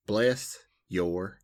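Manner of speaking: slow, spaced-out Southern drawl; mock Southern politeness, very slow; Southern accent. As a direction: draw each word out in Southern style. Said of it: "I like how the accent".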